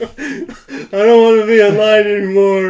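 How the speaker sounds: laughing mock wail. Reacting to body pillow fan, laughing mock wail. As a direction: wail it while cracking up.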